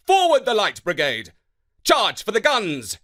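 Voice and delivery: loud, high battle cry; rally the cavalry with a shout; commanding shout. Acted. Commander ordering the brigade into the charge, loud shout. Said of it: "he said".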